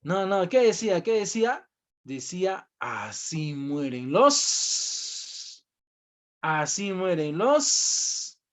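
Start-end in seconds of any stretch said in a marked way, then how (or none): 2.8-8.4 very slow, drawn-out, ominous sign reading. Retold or imitated. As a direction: drag each word out like a grim warning. Teacher reads the death sign aloud. (lectura lenta y ominosa, arrastrando las palabras)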